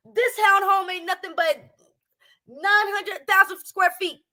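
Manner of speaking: normal talking voice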